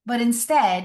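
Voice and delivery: ordinary talk, nothing marked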